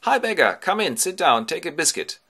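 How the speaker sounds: high, loud, hearty welcome; friendly swineherd. Acted. Swineherd receiving the disguised beggar, friendly loud welcome. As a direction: hail a visitor cheerily. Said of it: "his friendly swineherd, receives him well".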